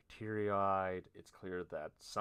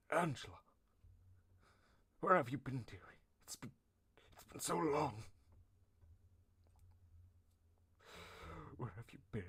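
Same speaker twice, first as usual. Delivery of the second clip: higher-pitched, acted weepy voice; tearful father; 7 semitones higher, faster than usual. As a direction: play at crying with relief at a found daughter. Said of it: "this man starts crying".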